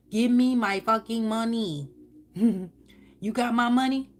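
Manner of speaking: sassy, swaggering shakedown; demanding money. Acted. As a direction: demand it like a playful debt collector. Acting out demanding money back.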